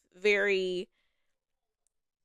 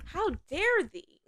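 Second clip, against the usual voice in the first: mock outrage; 8 semitones higher, 5 dB quieter, slower than usual.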